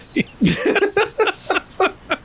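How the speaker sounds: high-pitched laughing burst; real laughter. Real, not acted. Big laugh.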